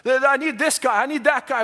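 ordinary talk

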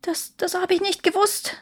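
high, pleading whimper. A narrator's reading. Dying Emperor answers the accusing faces, whimpering. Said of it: "wimmerte der Kaiser".

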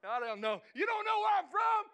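shouted high, playful street swagger; mock tough shout. Preacher playfully shouting at congregation, mock street swagger.

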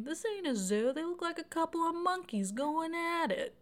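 hammed-up telling-off of a kissing couple; loud mock scolding. [name] scolds a couple sucking face at a bar, mock scolding. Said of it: "[name] loudly scolds them".

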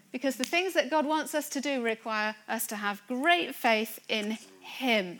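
ordinary talk, nothing marked

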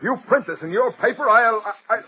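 high, loud, sputtering rage; furious stammering threat; angry threat. Acted. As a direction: lose control mid-threat. Robertson threatening the reporter.

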